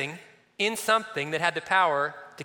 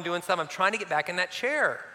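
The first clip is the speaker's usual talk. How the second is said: ordinary delivery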